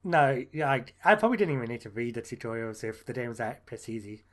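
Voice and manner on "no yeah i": ordinary talk, nothing marked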